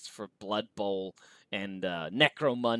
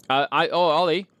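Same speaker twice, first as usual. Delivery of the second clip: turning away from phone; 5 semitones higher, 8 dB louder than usual. Real, not acted. Calling to son off-mic.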